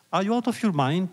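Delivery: a governor's indignant cry, voiced high but not at full volume; retold shout. Governor to Rabbi Akiba, offended by the dream: mild mock shout. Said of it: "the governor shouted".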